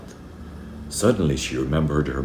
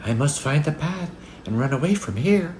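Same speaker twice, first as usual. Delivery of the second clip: put-on high, anxious child's voice; high-pitched childlike worry; frightened little girl; 12 semitones higher than usual. A narrator's reading. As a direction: urge yourself to flee, as a scared girl. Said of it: "Now, quite afraid".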